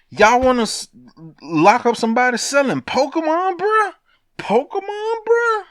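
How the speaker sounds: can't believe they locked him up; indignant squeal at the feds over a Pokemon seller; incredulous. Real, not acted. Outraged over Pokemon hacker arrest.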